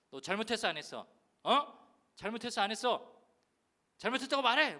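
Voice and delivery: put-on loud, harsh demand to a child; angry yelling. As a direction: force him to say sorry. Father yelling at small son. (아이를 다그치는 화난 고함)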